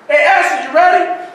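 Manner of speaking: mock dad calling up the stairs; loud fatherly call upstairs.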